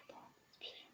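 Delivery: breathy whispered breath-in cue; hushed whisper. Real, not acted. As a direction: whisper it to yourself. Whispered aside to herself.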